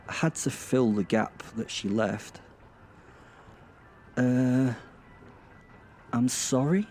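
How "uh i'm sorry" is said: drawn-out "uh", rising questioning apology; awkward apology. A narrator's reading. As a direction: offer an unsure apology, pitched up at the end. Awkward guess to fill the silence.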